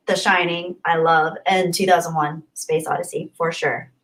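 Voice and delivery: ordinary talk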